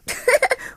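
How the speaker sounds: high giggle; puppy giggle. Acted. Pups plotting to fool Cruella, giggling. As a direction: giggle with glee.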